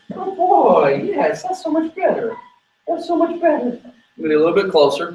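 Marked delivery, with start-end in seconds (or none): 0.1-3.7 much higher sing-song praise; high-pitched doggy praise; praising the dog. Real, not acted. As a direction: lift your voice high to reward the dog. Praising the dog mid-training.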